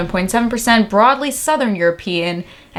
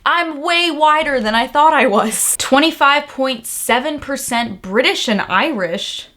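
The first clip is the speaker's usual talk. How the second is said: ordinary talk, nothing marked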